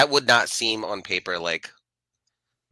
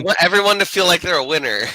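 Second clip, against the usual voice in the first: mock cheerful; 10 semitones higher, 7 dB louder, faster than usual. Joking over-cheerful line.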